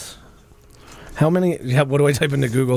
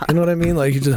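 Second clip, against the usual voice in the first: normal talking voice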